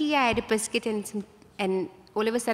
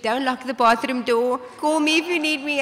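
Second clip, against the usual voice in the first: higher and louder anxious mom; a mother urging her daughter in labor, higher and louder; worried mother; 4 semitones higher, 6 dB louder than usual. Retold or imitated. Mother's anxious instructions voiced.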